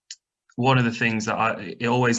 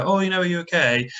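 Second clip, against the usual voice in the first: put-on soothing a fallen child; 8 semitones higher than usual. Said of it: "scoop them up and go".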